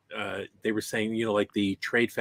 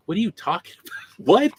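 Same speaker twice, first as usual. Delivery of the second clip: incredulous; 10 semitones higher, 5 dB louder, faster than usual. Retold or imitated. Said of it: "recalling own disbelief".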